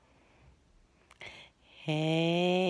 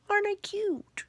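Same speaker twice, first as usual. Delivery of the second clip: cute high voice; 12 semitones higher, 3 dB quieter, much faster than usual. Pretend. Playing with face filters, cute voice.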